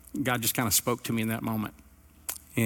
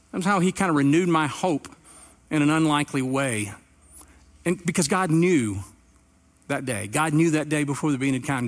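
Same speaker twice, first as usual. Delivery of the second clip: raised, higher passionate preaching; 3 semitones higher than usual. Acted. Impassioned sermon climax.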